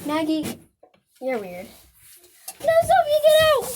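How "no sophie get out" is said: high, loud scold at the dog. Real, not acted. Chasing her dog from the cat food, loud scold. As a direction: shoo the dog off sharply.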